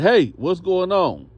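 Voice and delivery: loud, high, drawn-out challenge; guard calling out. Retold or imitated. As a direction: call across the room like a guard. Guard calls out to inmates.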